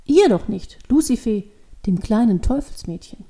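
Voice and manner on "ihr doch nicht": plain reading voice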